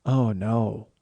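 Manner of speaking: a quiet, hammed-up gasp of doom; mock tragic dismay. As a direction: play it like a tragedy is unfolding. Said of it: "I just think it's funny to say, "Oh, no," as if there was some sort of tragedy afoot".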